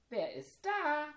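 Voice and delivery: high, loud call from the window; curious call from above. Acted. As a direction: call down from the top of the tower. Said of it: "rief sie".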